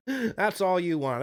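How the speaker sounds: teasing through a laugh. Real, not acted. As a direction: laugh while you tease.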